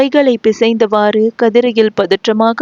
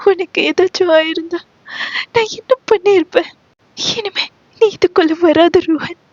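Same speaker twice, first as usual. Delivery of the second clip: high, sobbing, tearful pleading; sobbing like a child; 8 semitones higher than usual. Acted. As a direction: cry it out like a frightened child. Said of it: "விம்மி விம்மி அழுதாள்".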